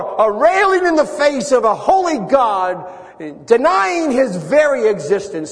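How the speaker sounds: impassioned preaching. Real, not acted. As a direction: preach it with ringing, emphatic force. Preaching against those defying God.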